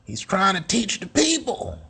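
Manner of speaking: high, sneering mock voice of a detractor; high mock critic voice, falling at the end. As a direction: sneer it like someone who resents him. Mocking a hostile critic.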